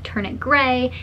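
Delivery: ordinary talk, nothing marked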